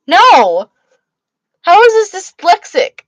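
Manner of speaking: high, loud indignant no; protest, outraged but playful. Real, not acted. Indignant denial to friend.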